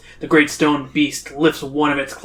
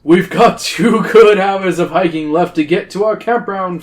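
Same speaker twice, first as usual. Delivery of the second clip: louder teacher announcement voice; Missus Weedle; 3 semitones higher, 5 dB louder than usual. A narrator's reading. Mrs. Weedle briefing the kids, teacher voice. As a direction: address the whole hiking party emphatically. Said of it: "Missus Weedle starts talking again".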